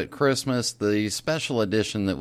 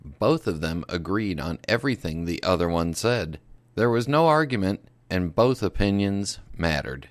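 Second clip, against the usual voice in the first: grave news read; 4 semitones lower, 3 dB quieter than usual. Acted. Spoof news bulletin read out.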